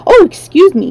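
startled apology, high and loud; high-pitched, flustered pardon; hasty apology. A narrator's reading. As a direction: jump back and blurt an apology. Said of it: "he replied, hastily drawing back".

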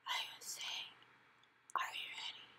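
very soft whisper. Acted. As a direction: breathe it into his ear. Sophie whispering into his ear.

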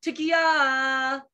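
tekiah held out like a horn blast; called like a shofar blast. Shown as a demonstration. Teacher leading children, calling Tekiah like a horn. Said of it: "Can you all go".

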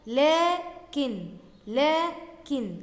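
لكن very slowly, with a long "laaa"; long alif in لكن. Shown as a demonstration. Teacher adding an important word, لكن stretched to show the alif. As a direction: stretch the first syllable of لكن, "laaa-kin". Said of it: "بعد اللام برضو في ألف تنطق".

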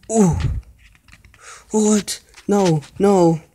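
high, loud panicked no; scared yell. Real, not acted. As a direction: cry out in sudden fright. Chased in horror game.

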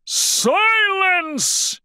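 high, drawn-out furious bellow; roar. A narrator's reading. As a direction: roar for silence. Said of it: "Bear roared".

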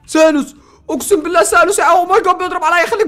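high, loud alarmed shouting; excited panic. Real, not acted. Gamer spotting the boss Sanos, loud excited panic. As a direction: freak out as the boss appears.

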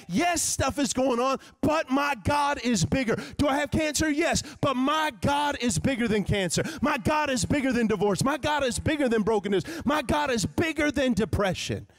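high, driving preacher refrain; full-force preaching. Real, not acted.

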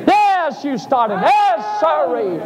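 high, ringing exclamations; preacher's high ecstatic cry; crying out to God. Retold or imitated. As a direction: shout the revelation up to heaven.

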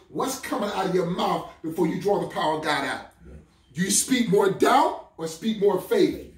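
normal talking voice

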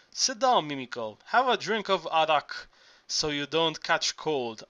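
ordinary delivery

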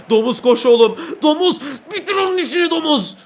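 high, loud yell at the player; shouting at the pig to finish it; shouting encouragement. Real, not acted. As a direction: urge him on like a fan.

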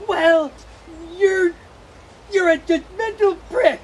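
angry outburst. Acted. Bee yelling at the bear, angry. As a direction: finally snap back at the bully.

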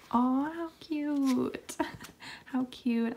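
high, slow, drawn-out coo. Real, not acted. Vlogger cooing to viewers over a cute photo card. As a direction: melt over the cute card like it's a puppy.

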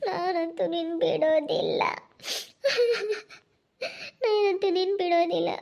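slow, high tearful pleading; crying. Acted. Girlfriend begging Bheemu not to leave her, crying. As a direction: beg him not to let go through sobs. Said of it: "ಅಳ್ಬೇಡ ನೀನು".

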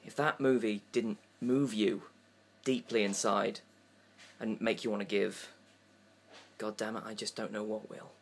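sincere donation plea, hushed; charity appeal. Acted.

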